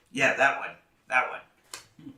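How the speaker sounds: usual speaking voice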